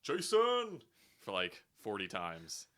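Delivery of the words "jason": drawn-out, high, loud shout for a son; imitated desperate yelling. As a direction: yell it like a dad chasing his son. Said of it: "he kept yelling".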